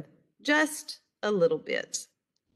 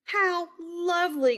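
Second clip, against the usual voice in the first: much higher, sweet granny voice; delighted granny; 10 semitones higher than usual. A narrator's reading. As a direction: coo it like a pleased grandmother. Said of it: "Granny said".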